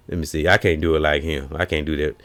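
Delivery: normal talking voice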